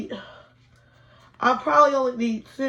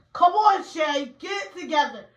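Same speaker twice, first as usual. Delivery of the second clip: self pep shout; 6 semitones higher than usual. Real, not acted. Urging herself through the pain.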